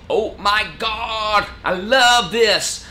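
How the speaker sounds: loud, high, drawn-out American gushing; mock American accent. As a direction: gush slowly in a hammed-up American accent. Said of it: "I can do the American accent".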